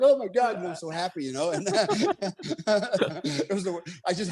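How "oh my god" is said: higher, louder gasp of relief; hugging his teammate, joyful relief; relieved joy. Retold or imitated. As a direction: cry out with relief. Hugging each other in relief.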